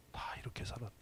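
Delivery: hushed, breathy whisper of the sermon's point; whispered emphasis. Real, not acted. Preacher whispering the lesson to the congregation, hushed. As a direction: drop to a whisper to land it.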